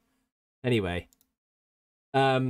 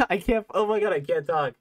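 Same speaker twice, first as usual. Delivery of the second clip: higher, laughing too much to talk; helplessly cracking up; real giggles; 5 semitones higher than usual. Real, not acted. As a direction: giggles breaking up the sentence. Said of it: "having the giggles now".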